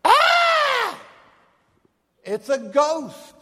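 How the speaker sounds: high, loud terrified shriek, then a slow shaken gasp; fearful cry. Acted. Disciples in the boat see a ghost, terrified scream. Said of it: "grown men cry out in fear".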